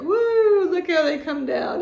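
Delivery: high, loud "woo" easing downward; playful delight. Real, not acted. Vlogger showing off her glittery pen, playful delight. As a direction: marvel like it's a magic toy.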